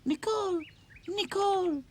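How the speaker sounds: very high calling, worried. A narrator's reading. Worried girl calling for her lost doll, very high. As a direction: call for your lost doll. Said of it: "She was calling".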